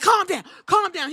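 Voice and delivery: hammed-up calm-down shout; mock shout. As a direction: yell calm down in character. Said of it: "Seth was like".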